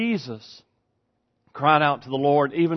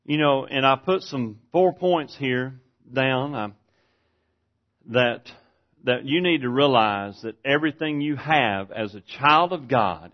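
ordinary delivery

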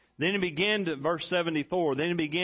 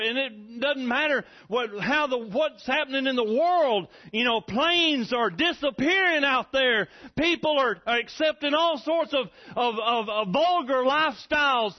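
much higher and louder, building to full force; fiery preaching; 9 semitones higher, 4 dB louder than usual. Real, not acted. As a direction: thunder it at the congregation.

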